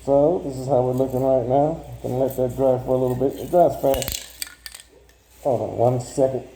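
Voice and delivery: muffled through a mask; talking through a respirator mask; underwater. Real, not acted. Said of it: "it sounds like I'm underwater".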